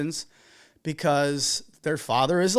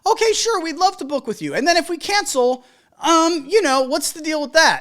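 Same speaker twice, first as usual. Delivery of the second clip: high, bright-eyed mock client voice, climbing up high; imitating an eager client, voice much higher; mock sweet client; 9 semitones higher, 4 dB louder than usual. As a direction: play the innocent client asking sweetly. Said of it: "when you see people saying".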